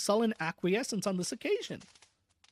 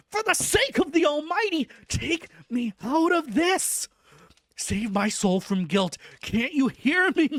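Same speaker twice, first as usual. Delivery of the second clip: loud, high, desperate begging; frantic pleading; 5 semitones higher, 5 dB louder than usual. Acted. Madman begging the doctor for release, frantic and loud. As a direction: plead to be let out, voice cracking up high. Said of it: "he got into quite a frantic condition".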